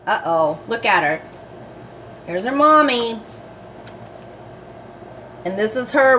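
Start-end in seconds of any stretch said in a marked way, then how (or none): none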